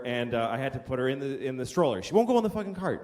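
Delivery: usual speaking voice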